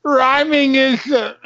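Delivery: high, slow mock sobbing; hammed-up crybaby policeman; sobbing cop. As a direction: blubber it out between sobs. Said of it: "Cop says".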